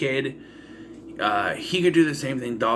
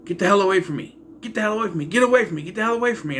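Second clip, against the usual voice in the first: raised, higher-pitched imitation of yelling; mock yelling; 6 semitones higher, much faster than usual. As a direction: yell it over and over, half in parody. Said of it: "he just keeps yelling".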